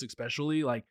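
usual speaking voice

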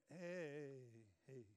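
soft, low, drawn-out "hey" through pretended friendliness; mock-friendly, subdued hello; awkward fake greeting. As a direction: greet someone you'd rather avoid, half-hearted.

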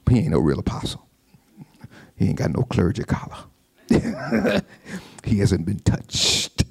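low sly mockery; low mocking gossip voice; mocking troublemaker. Mocking whisperers undermining Paul.

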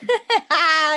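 high, loud burst of laughter; big amused cackle. Real, not acted. Laughing at co-host's regret.

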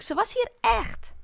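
high, loud and slow insisting; insistent crying out. Acted. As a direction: stress every word, desperate to be believed. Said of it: "roep ik". (hoog, luid en traag aandringend)

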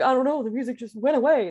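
a light mock of a clueless drummer; shrugging excuse. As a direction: play dumb, it's not my fault. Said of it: "That was the, the look he was giving".

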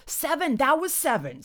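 high-pitched excited count; excited exclamation. A narrator's reading. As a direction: blurt it out to win the argument. Excited arguing count of hoots.

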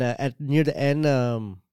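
ordinary talk

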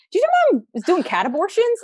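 high, excited disbelief at the news; shocked gossip. Retold or imitated. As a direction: blurt it out to your sister, scandalized. Calling sister with shocking news.

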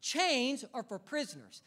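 ordinary delivery

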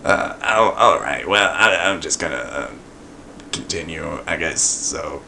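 hesitant, baffled mumble; confused. Retold or imitated. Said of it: "with this dumb look on his face like".

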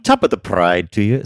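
lightly voiced jolly leprechaun; a hint of a cheery Irish sprite; Pride Leprechaun. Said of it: "says the Pride Leprechaun as well".

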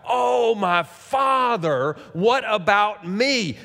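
drawn-out, high, loud plea; bitter cry. Acted. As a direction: wail it slowly, stretching every word. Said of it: "a loud and a bitter cry".

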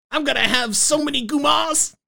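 put-on baby mobster. Baby Soprano boasting about future mistresses, put-on child voice. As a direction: boast as a pretend little gangster.

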